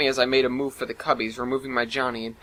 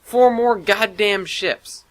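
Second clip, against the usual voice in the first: high, loud, slow angry outburst on Four; furious spat words; 8 semitones higher, 5 dB louder, much slower than usual. Acted. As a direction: spit each word in disbelief. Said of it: "I spat".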